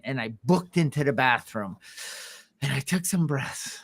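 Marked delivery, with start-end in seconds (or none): none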